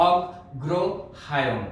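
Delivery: অগ্রহায়ণ said very slowly, with a doubled g; অগ্রহায়ণ pronounced piece by piece for pupils; broken into syllables. Shown as a demonstration. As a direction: break অগ্রহায়ণ into slow syllables. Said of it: "ভেঙে ভেঙে প্রথমে উচ্চারণ করাবো".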